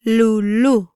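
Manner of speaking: "Lulú" drawn out for learners; slow pronunciation demo. Shown as a demonstration. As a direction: stretch "Lulú" into two long syllables. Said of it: "try pronouncing these words".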